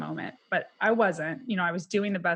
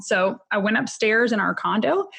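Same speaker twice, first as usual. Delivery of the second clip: ordinary delivery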